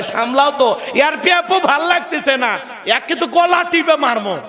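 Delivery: high, exasperated mock scold; irritated wife. Annoyed mother hands baby to husband, mock irritated. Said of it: "রাগান্বিত হয়ে বলে".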